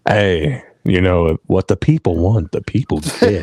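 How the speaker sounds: low, close-mic ASMR voice; mock ASMR, low and intimate; playful ASMR. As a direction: murmur right into the microphone, lingering between words. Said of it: "not the A S M R".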